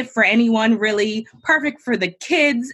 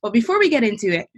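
normal talking voice

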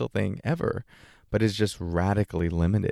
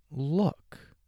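usual speaking voice

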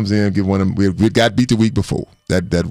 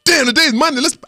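imitating his own hyped younger self; pumped-up player; 11 semitones higher than usual. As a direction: sound like you can't wait to hit the field. Hyped player quoting himself.

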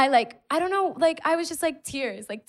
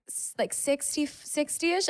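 higher, softer, sheepish lowball; sheepish fib; 8 semitones higher, 5 dB quieter, slower than usual. Retold or imitated. Answering the cop about her speed, higher and sheepish. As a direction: fib it meekly, voice creeping up.

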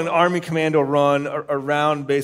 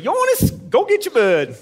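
high hammed-up Maine accent, name pitched way up; Scotty's Maine voice; 12 semitones higher, 3 dB louder than usual. Storyteller imitates Scotty ordering him to fetch the turkey, high Maine accent. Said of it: "without doing his voice".

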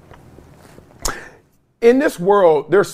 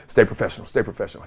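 hushed inner reminder, much softer; muttered to self; 8 semitones lower, 7 dB quieter, much faster than usual. Retold or imitated. Himself, reminding himself at the sight of the reporter, in a hushed mutter. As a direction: talk yourself down under your breath. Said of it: "In my head, I'm like".

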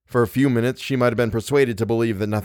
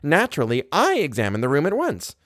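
high-pitched, imperious scolding; 11 semitones higher than usual. A narrator's reading. Wife interrupting her husband to scold him, imperious. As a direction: cut in and put your husband down.

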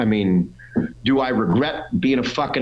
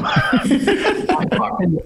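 laughing; much slower than usual. Real, not acted. Laughing at his own story.

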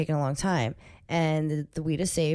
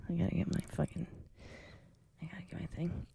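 whispered; 12 dB quieter, faster than usual. Real, not acted. Mutters aside.

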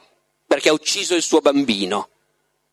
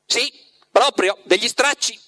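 spiteful cat; 7 semitones higher than usual. A narrator's reading. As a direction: grumble spitefully about rags. The cat spiteful, paw bang.

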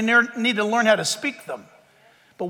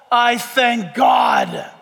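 slow proclamation; 3 dB louder, much slower than usual. Real, not acted.

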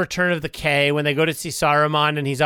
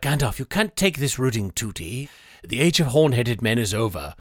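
low solemn Elrond; 8 semitones lower than usual. Retold or imitated. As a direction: intone it gravely as the elf lord. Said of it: "Elrond's like".

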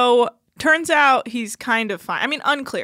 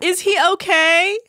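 mock worried Amy; 8 semitones higher, 4 dB louder, slower than usual. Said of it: "And she's like".

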